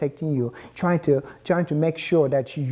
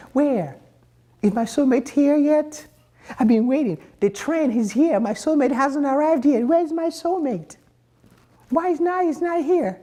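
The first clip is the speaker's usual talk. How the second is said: much higher, pleading mock whine; whiny lonely seeker; 10 semitones higher than usual. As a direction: play a desperate single waiting at the station, voice pitched way up. Acts lonely soulmate-seeker at station.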